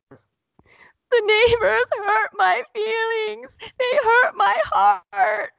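put-on very high, loud, drawn-out child's cry; imitated sobbing wail. Son bear-hugged by his mother, mock heartbroken wailing. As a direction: wail each word like a heartbroken little boy. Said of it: "he's still crying so hard".

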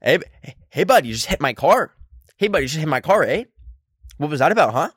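mock rural Canadian accent with rising "eh?". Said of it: "a really redneck Canadian accent".

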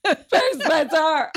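very high wailing through laughter; mock dying mom. Son mocking his dying mom calling for Spencer, very high. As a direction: wail for Spencer like a dying mother. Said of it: "what your mom's gonna do".

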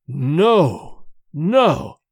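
slow, emphatic refusal; stubborn refusal. A narrator's reading. Old man refusing his family's plea to pray, stubborn. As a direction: refuse outright, each word on its own.

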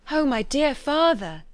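high, slow, drawn-out plea; earnest entreaty. A narrator's reading. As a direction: implore your father. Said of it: "she cried".

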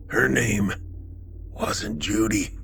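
faint, slow, breathy; weak whisper. Acted. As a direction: whisper the secret, fading out. Dying man whispers revelation.

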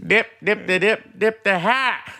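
high, playful Southern sing-song; high mock-Southern chant; Southern accent imitation. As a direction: savour the nonsense like a Georgia lady. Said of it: "Oh, man, I love that".

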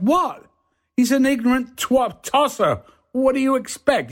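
mock indignant retort. Actor answers his offended colleague outside the pub, mock indignation.

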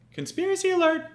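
high, loud siren-like call of the words; mock alarm announcement. Host kicks off his conspiracy segment with a mock alarm. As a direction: sound the alarm like a cheesy segment jingle.

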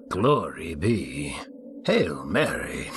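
slow, mocking echo of prayers; sarcastically quizzical. A narrator's reading. Priest echoing the penitent's question, sarcastically quizzical. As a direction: drag out each prayer name, sarcastically puzzled. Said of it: "now adopting a sarcastically quizzical tone".

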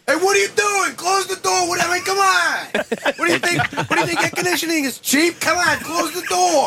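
high-pitched imitated dad rant; yelling Boston dad. Dad yelling at son as pizza arrives, mock yelling. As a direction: scold like an exasperated Boston father. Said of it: "So he's yelling".

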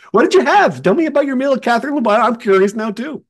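high, loud and fast curious questions; imitating an intrigued coach; mock eager coach. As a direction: play the nosy impressed coach. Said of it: "Even Kevin Willard was like".

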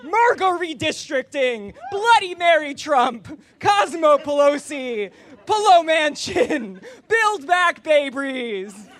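slow, high, shouted list of names; loud sharp voice. Acted. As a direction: belt out each name like a hype man. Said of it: "yell all the cocktail names".

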